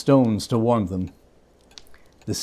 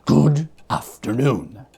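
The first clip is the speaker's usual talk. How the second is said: slow curt dismissal; slower than usual. A narrator's reading. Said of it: "said Scrooge".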